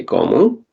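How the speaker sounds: qawmu ending on a short u, no n; light form. Shown as a demonstration. Teacher building his nation, light qawmu with short final u. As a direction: end qawmu lightly on a bare u. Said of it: "by keeping it light".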